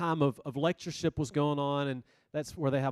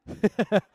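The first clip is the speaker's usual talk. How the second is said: real short high laugh; amused burst of laughter; loud quick chuckle; 7 semitones higher, 8 dB louder than usual. Real, not acted. Laughs at his own story.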